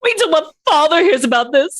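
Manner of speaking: Draco Malfoy whine. Retold or imitated. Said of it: "Like Harry Potter".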